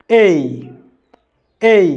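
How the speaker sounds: "এই" drawn out as "ei"; correct letter A, repeat. Shown as a demonstration. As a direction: model "এই" slowly for students to copy. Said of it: "আবার বলেন আমার সাথে". (শিক্ষকের মতো "এই" উচ্চারণ)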